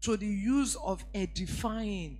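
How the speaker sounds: slow, weighty reading with punched words; scripture reading. Acted. Preacher to congregation, slow solemn scripture reading. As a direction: read the verse like a pronouncement.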